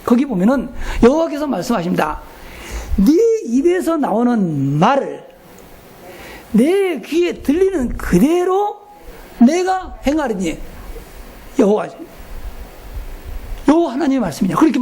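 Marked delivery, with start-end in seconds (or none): 3.0-10.6 slow, weighty reading of God's words; solemn scripture reading. Acted. 목사가 신도들에게 민수기 말씀을 인용하며, 천천히 장엄하게. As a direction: draw out each phrase like a holy proclamation. Pastor quotes Numbers 14:28.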